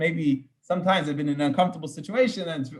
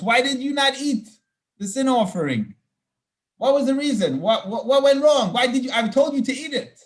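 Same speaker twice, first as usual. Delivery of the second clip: angry rebuke; 8 semitones higher, 4 dB louder than usual. Retold or imitated. Moses rebuking sons, verse paraphrase.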